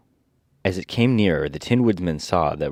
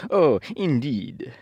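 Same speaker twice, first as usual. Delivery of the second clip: slow, higher-pitched reply; impressed Tin Woodman; 8 semitones higher, slower than usual. Acted. Woodman bowing to the Queen, slow impressed reply. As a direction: draw it out as the Woodman.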